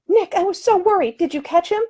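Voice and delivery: a proper lady, lightly voiced, scolding; high-voiced prim woman, firm and offended; indignant lady. Woman relieved to see Nick.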